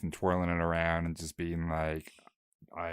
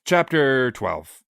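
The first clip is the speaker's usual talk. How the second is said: loud, slow, higher chapter heading; title announcement; 6 semitones higher, 8 dB louder, slower than usual. Pretend. Co-host retaking the chapter title after fumbling, slow loud announcement. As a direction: announce it grandly, stretching the words.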